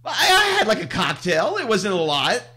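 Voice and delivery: high, loud, stammering protest; defensive. Acted. Caught renter excuses himself to the Greek owner, squeaky and defensive. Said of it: "And I said".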